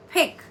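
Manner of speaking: "Pick" with a crisp aspirated p and short i; pick said alone. Shown as a demonstration. Teacher modelling "Pick" clearly for learners. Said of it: "it's pronounced as pick".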